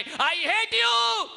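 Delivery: high, loud, very slow shout; child shouting. Retold or imitated. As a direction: throw it at the hills. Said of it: "आवाज लगाई".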